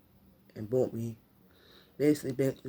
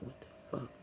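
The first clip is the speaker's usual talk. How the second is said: very soft, half-whispered shock; hushed disbelief; 15 dB quieter than usual. Retold or imitated. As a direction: retell it under your breath, stunned. Retold shocked reaction, voice drops away.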